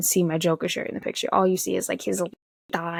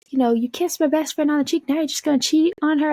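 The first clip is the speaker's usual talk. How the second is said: higher-pitched teasing accusation; mock accusation; 8 semitones higher, 3 dB louder than usual. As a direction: call him out in a higher voice. Friend confronts him in retold quote.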